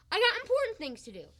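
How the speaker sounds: loud, high-pitched protest; indignant outburst. Acted. Husband brushing off the doctor, indignant outburst. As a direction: declare it hotly, self-important. Grumbling at the visitor.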